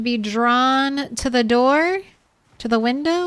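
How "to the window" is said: ordinary talk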